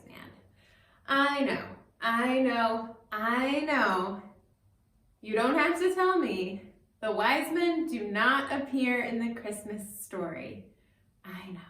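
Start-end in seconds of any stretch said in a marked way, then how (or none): 1.1-6.6 playful mock defensiveness. Anticipating kids' objection about wise men. 11.2-11.6 a soft conspiratorial whisper; whispered aside. Acted. Storyteller conceding to the children, whispered aside.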